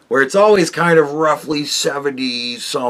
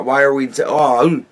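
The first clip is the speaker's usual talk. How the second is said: ordinary delivery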